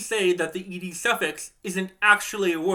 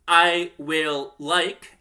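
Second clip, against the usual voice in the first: "I will like" slow, each word apart; separated out slowly; 5 dB louder, much slower than usual. Shown as a demonstration. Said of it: "separate it out like this".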